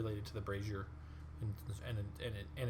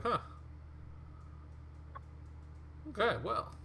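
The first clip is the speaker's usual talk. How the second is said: lightly voiced puzzled mummy; a bemused little shrug in the voice; mummy's shrug; 10 semitones higher, 4 dB quieter than usual. Game master voicing the mummy looking down at himself, bemused. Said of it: "and he's just like".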